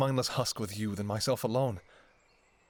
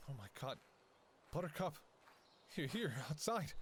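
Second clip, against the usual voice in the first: ordinary talk, nothing marked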